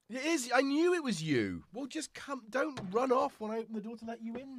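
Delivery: fond telling-off of a pet; talking to the cat. Real, not acted. Owner scolding her cat at the door, high pet-talk.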